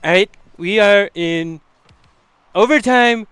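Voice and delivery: high, drawn-out Trump voice; Trump impression. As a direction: announce it like Donald Trump. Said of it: "Why am I casting with Trump right now?".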